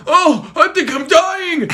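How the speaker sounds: high, slow mock agony cry; slow, high melodramatic groan; hammed-up dying. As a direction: play the dying victim big. Boy dramatically dying.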